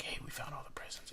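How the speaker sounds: soft conspiratorial whisper. Retold or imitated. Brother whispering to his sibling about the hidden presents, secret whisper. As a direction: hush it like a secret discovery.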